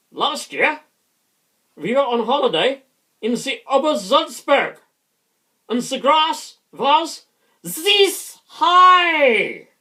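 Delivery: put-on loud, high shouted German accent. Comedian telling a gag on stage, shouted German accent. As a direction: comedian barking a military German-accented joke into the mic. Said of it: "in a German accent".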